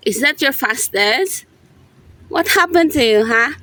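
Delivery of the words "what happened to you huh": ordinary delivery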